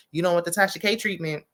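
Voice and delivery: ordinary delivery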